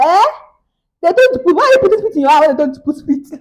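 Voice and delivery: loud, high imitated outburst of disbelief; incredulous mother. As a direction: imitate mom demanding an explanation, appalled. Mother's shocked reaction, acted.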